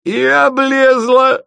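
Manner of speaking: very high, loud, drawn-out crow; guffawing taunt. A narrator's reading. Drunk friend mocks the bride, guffawing. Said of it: "захохотал Лёлик".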